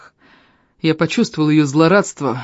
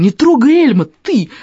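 much higher, louder battle cry; defiant shout; 9 semitones higher, 6 dB louder than usual. Acted. Defending Elm from the demon, loud shout. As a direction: charge in with a shout.

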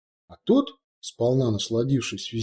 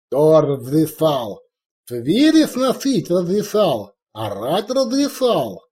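slow, louder, higher furious dressing-down; angry scolding; 5 semitones higher, 5 dB louder, slower than usual. Acted. Orc chief berates his cowering warrior, furious. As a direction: hammer each word at the underling.

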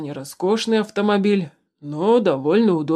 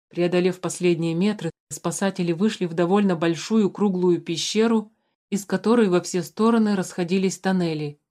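usual speaking voice

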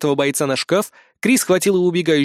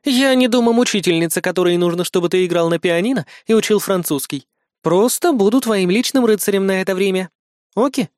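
higher female voice, reassuring; friendly bodyguard, pitched up at the ends; friendly Kri; 4 semitones higher than usual. Acted. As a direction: coax the boy in a lifted voice. Кри успокаивает мальчика.

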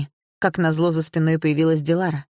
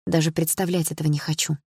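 ordinary delivery

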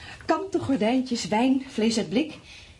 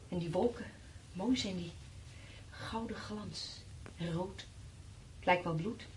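hushed wonder; 3 semitones lower, 13 dB quieter, slower than usual. Acted. Wife gazing at the red dusk.

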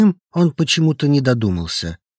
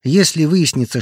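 ordinary delivery, nothing marked

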